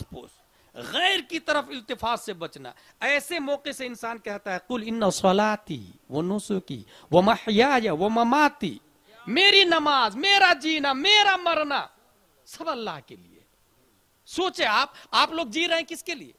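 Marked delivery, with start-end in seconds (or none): 4.6-13.4 slow recitation rising into a louder declaration; recited verse. Acted. Preacher reciting the Quran verse to listeners, solemn and rising. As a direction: intone the verse, then proclaim its meaning.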